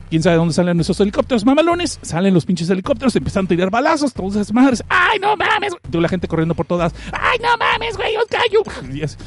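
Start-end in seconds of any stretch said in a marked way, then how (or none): 4.9-8.9 much higher, squealing mock panic; high comic panic; panicked crowd. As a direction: shriek like a fleeing pedestrian. Voicing fleeing crowd panic.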